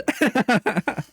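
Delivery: high chuckle at himself; self-mocking burst of laughter; laughing. Real, not acted. Calling himself a chud, laughing.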